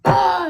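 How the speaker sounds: a sudden loud grunt of effort; loud slam noise. Retold or imitated. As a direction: bark out the impact. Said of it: "I grabbed her by the hair and I went".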